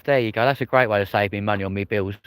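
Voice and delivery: normal talking voice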